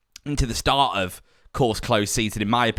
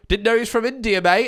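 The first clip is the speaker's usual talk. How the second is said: higher, teasing remark about a bad accent; mock teasing quip; 10 semitones higher than usual. Teasing a friend's bad impression, in a higher mock voice. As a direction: rib someone's bad impression, pitched up. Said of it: "Whenever someone does an impression".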